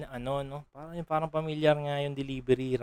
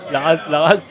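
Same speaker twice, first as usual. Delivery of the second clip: hyped; 10 dB louder than usual. Real, not acted. Hyped reaction to a bar.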